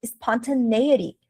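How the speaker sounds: "Spontaneity" drawn out as spon-tuh-NAY-ity; native speaker's pronunciation. Shown as a demonstration. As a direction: repeat "Spontaneity" slowly, stressing NAY. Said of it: "O cara falou spontaneity".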